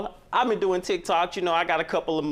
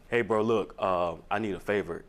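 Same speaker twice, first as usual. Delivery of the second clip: much lower, lazy slurred mock brother; brother's voice; 9 semitones lower, 4 dB quieter than usual. Man mimicking his brother calling for a favor, low lazy mock voice. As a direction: drop the pitch and slur like a brother asking a favor. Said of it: "Did he talk like this?".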